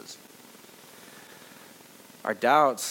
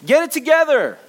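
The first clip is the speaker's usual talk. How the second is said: much higher, louder exasperated outburst; mock self-berating; 11 semitones higher, 9 dB louder than usual. Adults scolding themselves, loud exasperated shout. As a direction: yell at yourself in frustration. Voicing harsh self-criticism.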